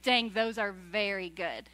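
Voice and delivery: genuinely impressed. Retold or imitated. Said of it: "we're like".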